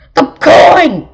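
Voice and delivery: high, loud shout; angry call. Acted. Baron ordering his noisy crow to stop, angry shout. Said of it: "called the Baron von Grump".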